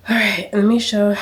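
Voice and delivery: breathless from moving around; puffing between words; out of breath. Real, not acted. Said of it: "Whew, I'm out of breath".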